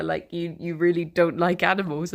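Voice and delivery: normal talking voice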